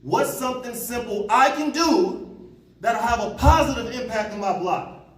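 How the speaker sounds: slow emphasis. Real, not acted. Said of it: "key question stressed slowly".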